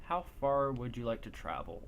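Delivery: ordinary delivery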